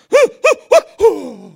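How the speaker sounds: high loud pained gasps in a row; panting gasps. Retold or imitated. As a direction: gasp as the tooth is pulled. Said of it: "And I went".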